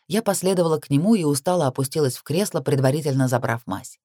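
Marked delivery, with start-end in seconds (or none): none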